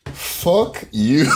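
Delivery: laughing fuck you. Pretend. Pretending to answer the letter, laughing. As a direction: fire it back through laughter. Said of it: "Well, I would've sent back".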